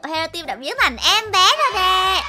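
high, loud wow easing down; amazed excitement. Pretend. Host sees the team turned into babies, amazed. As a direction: exclaim in wonder to viewers.

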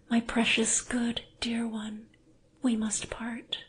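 gentle parting words; tender whisper. Acted. Anna, tender farewell whisper.

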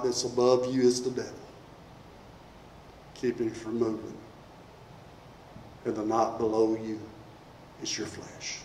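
low, very soft preaching with long pauses; hushed altar appeal. Real, not acted. Preacher urging a fearful listener to let go, hushed appeal. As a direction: speak gently and low to one troubled soul.

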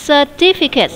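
Certificate with the second syllable, -tif-, stressed; teacher demo, land on -tif- in Certificate; Certificate, weight on -tif-. Shown as a demonstration. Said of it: "nhấn âm hai".

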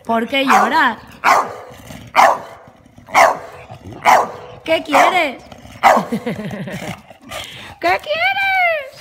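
pet talk. Real, not acted. Talking to whining dog.

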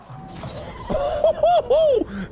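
high-pitched laugh swelling up; giddy high cackle. Real, not acted. Laughing at game mishap.

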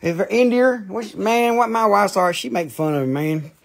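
ordinary delivery

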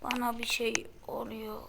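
hushed whisper to himself; scared whisper. Acted. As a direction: breathe it out, uneasy. Scared realization in game.

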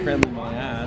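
ordinary talk

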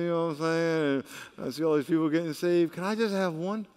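coaxing, level-pitched bargaining with God; wheedling plea. Acted. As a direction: plead sheepishly, as if asking a favour. Acts his own pleading to God.

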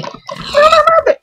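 very high, slow begging piggy squeal; squeaky pig voice. Pretend. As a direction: plead for your life as a tiny piggy. (cerdito suplicando con voz muy aguda y lenta)

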